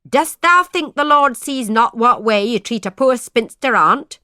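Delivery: loud, high accusing cry. A narrator's reading. Spinster aunt crying out at her brother's wife. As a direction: cry it out, stung and self-righteous. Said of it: "cried she".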